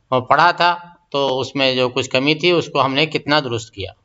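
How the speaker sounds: ordinary delivery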